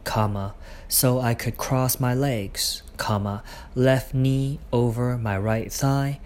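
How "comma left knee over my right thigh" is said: ordinary delivery, nothing marked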